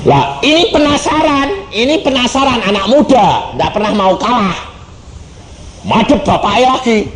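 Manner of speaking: mock-excited about a stubborn youth; animated preaching. (berkhotbah dengan bersemangat)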